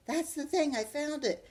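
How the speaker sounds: put-on high, loud thrilled boy; excited. Arthur thrilled at finding the thing, high excited. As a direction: burst with excitement.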